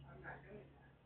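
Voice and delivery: very soft, low refusal under the breath; muttered refusal. Real, not acted. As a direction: say it to yourself, almost inaudible. Refusing bird's request.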